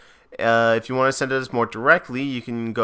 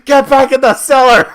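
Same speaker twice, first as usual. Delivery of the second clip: very high, loud comic yell; mock angry shout; 14 semitones higher, 11 dB louder than usual. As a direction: holler it like a hammed-up scolding. Shooing character with a broom.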